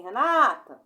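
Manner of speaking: loud Renata with a stretched "NA"; demonstrated shout, stress landing on "NA" in Renata; calling out. Shown as a demonstration. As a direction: call the name Renata as if to a friend. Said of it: "tu não me chamaria assim".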